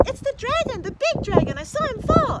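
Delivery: high, excited shout. A narrator's reading. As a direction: shout the alarming news to everyone. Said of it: "he heard someone shouting".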